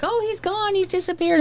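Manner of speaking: high startled cry; alarmed. Retold or imitated. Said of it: "she's all like".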